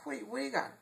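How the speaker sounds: sharp high "what"; surprised buyer. Retold or imitated. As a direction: react in disbelief. Acted buyer questioning dealer.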